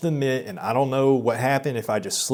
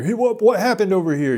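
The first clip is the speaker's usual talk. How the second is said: much higher, louder call; instructor's loud demand; 9 semitones higher, 6 dB louder than usual. Retold or imitated. Retelling the instructor demanding answers, high and loud. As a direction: bark out the question over everyone. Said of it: "the instructor".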